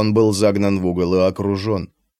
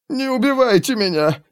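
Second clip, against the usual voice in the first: gurgling through a mouthful of blood; high plea bubbling through blood; 12 semitones higher than usual. Acted. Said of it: "пробулькал Драшер". (пробулькал с кровью во рту)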